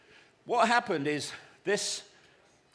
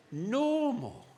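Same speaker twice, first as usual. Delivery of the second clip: higher, drawn-out disbelief; mock disgust; 7 semitones higher than usual. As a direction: recoil from the word as an insult. Recoils at being called normal.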